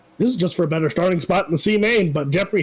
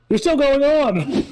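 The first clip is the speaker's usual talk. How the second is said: mock cheerful; 7 semitones higher than usual. Teasing the crashed driver.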